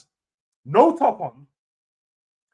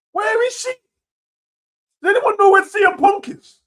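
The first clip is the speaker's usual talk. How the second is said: high, baffled question; high incredulous; 12 semitones higher, 6 dB louder than usual. Real, not acted. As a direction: ask it again much higher. Repeats question, higher.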